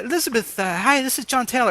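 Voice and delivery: high, put-on British accent. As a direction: pose as a British pop star greeting a fan. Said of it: "I do a great British accent".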